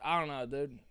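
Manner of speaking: ordinary talk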